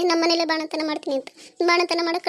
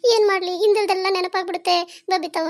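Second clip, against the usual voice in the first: ordinary talk, nothing marked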